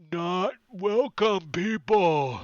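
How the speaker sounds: loud, slow, high-pitched ogre; ogre shout. Acted. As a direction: bellow it word by word as an ogre. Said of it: "one of the ogres says".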